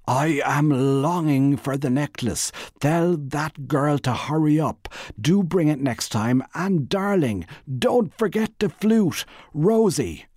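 mock-grand reading of the rival's letter; mocking booming read. A narrator's reading. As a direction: make the love letter sound ridiculous. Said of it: "read it in a booming voice".

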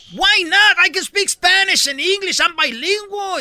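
high, loud indignant comeback in English; angry retort. Retold or imitated. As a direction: fire back, outraged. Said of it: "se encanija la morra". (réplica indignada, aguda y fuerte)

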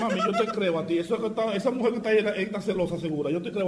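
quiet aside reassuring the wife; hushed reassurance. Acted. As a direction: soothe her quietly, half laughing. Husband soothing wife on call.

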